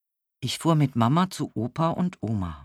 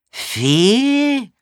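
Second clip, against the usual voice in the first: high, loud, incredulous echo; hard-of-hearing old man querying a misheard word; baffled old man; 10 semitones higher, 8 dB louder than usual. A narrator's reading. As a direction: swoop up loudly in disbelief. Deaf old man mishears tea.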